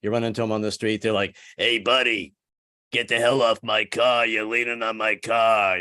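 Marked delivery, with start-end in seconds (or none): 1.6-5.8 ex-mafia guy. Retold or imitated. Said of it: "they have those raspy voices".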